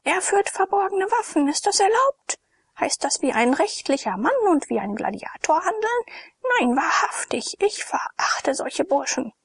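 shrill indignant woman. A narrator's reading. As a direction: scold like an outraged tavern wife. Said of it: "kreischte sie".

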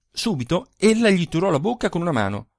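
ordinary delivery, nothing marked

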